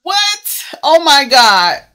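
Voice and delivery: loud, high cry of disbelief; shocked shouting. Real, not acted. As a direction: exclaim at full volume, stunned. Shocked outburst at being left unwarned.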